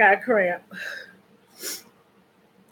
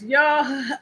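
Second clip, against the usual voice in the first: normal talking voice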